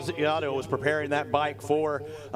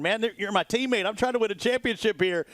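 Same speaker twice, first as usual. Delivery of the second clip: imitating a teammate; 7 semitones higher than usual. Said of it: "He's probably wondering".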